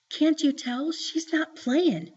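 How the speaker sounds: put-on high-pitched worried child voice; anxious warning. A narrator's reading. Joey warns hypnotized Byron about Mama, anxiously. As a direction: warn him urgently as a little sister. Sister warns brother of danger.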